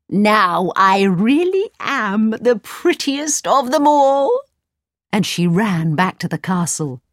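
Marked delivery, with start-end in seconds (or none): 0.1-4.3 evil queen. Acted.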